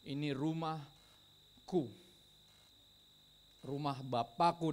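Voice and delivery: slow, weighty quotation with long pauses; slow, deliberate claim of ownership; solemn declaration. Acted. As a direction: draw out each word and let it land. Preacher acts out Jesus' declaration.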